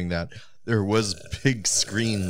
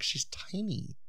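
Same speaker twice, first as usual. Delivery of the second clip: whispering; 10 semitones higher, 9 dB quieter, slower than usual. Real, not acted. Gossip aside.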